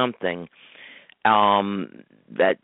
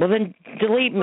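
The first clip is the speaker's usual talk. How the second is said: indignant; 12 semitones higher than usual. Real, not acted. Indignant retort.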